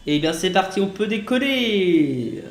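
high, slow, loud cheer; drawn-out cheer at takeoff; excited. Real, not acted. Takeoff in flight game.

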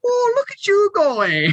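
very high, drawn-out mocking coo; mock admiration. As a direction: cheer them on sarcastically, slow and high. Mock cheering on a lifter.

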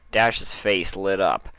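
plain reading voice

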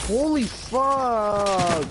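high, slow stunned cry; shocked. Pretend. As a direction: stretch it out in shock. Drawn-out exclamation.

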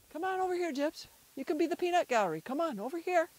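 calling out. Real, not acted. Owner beckoning an animal to the peanut gallery, calling out.

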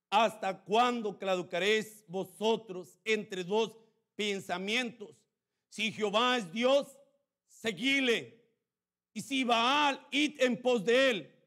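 loud, slow prophetic challenge; Elijah's challenge. Acted. Elijah confronting the wavering people, loud and slow. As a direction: demand an answer from the people.

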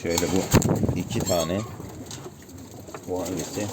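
usual speaking voice

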